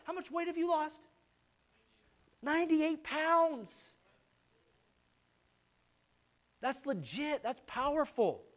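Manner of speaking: high and slow in awe; excited praise. Real, not acted. Preacher marvelling at the pastor's weight loss, high and awed.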